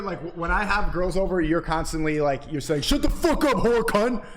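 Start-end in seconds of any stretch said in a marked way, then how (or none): 2.8-4.2 mock yell. Imitating his friend yelling at the girls, mock yell. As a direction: bark it out, hammed-up rage.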